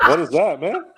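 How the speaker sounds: high, laughing "what is that"; laughing surprise. Real, not acted. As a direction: burst out in amused disbelief. Hears odd sound in clip.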